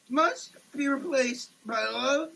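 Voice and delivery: ordinary talk, nothing marked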